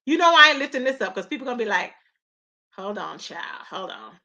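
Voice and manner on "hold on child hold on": much softer, lower muttered aside; a lightly voiced gossiping viewer; quiet mock warning; hushed. Imagined viewers' reaction.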